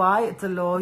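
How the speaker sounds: ordinary talk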